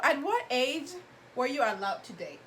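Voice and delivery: ordinary delivery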